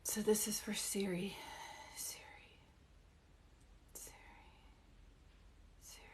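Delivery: soft breathy aside to herself; whisper. Real, not acted. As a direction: drop into a private whisper. Medium whispers to herself mid-reading.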